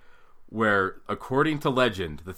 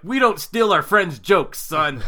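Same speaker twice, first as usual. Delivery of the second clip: loud, raised-pitch comic scolding; mock angry dad; 7 semitones higher, 7 dB louder, slower than usual. Father scolding son for stealing a joke, loud mock anger. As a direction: reprimand the boy with mock outrage. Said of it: "And I was really mad".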